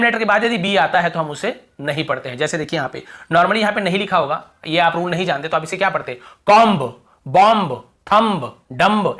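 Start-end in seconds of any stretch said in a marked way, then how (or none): 6.5-9.2 "कॉम्ब, बॉम्ब, थंब, डम्ब" each loud and separate with an audible final b; B wrongly sounded. Shown as a demonstration. Teacher shows readers ignorant of the rule, final b pronounced. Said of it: "ऐसे पढ़ते हैं ना, ब का उच्चारण करते".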